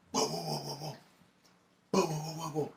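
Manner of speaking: high voice calling whoa through the door; voice outside. Retold or imitated. Stranger outside the apartment door, high calling voice.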